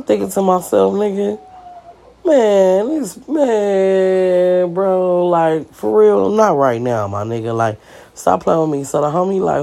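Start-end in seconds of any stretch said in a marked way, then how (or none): 0.9-8.8 exasperated inner rant. Retold or imitated. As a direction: let the frustration build and then drop. Thinking to myself, exasperated.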